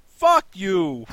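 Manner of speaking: loud, high shouted comeback; hostile retort. Retold or imitated. New Yorker answering a friendly stranger, hostile shout. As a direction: fire it back as a big, loud rebuff. Acting his rude reply to greeting.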